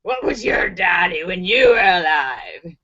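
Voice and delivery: deep demonic questioning; Satan. Acted. Satan questions the second dead man, menacingly. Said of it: "Satan asks the second guy".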